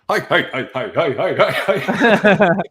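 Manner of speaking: high, loud laughing hi's; mimicking the gnome hi's. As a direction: copy the creature's hi's while cracking up. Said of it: "he's just going".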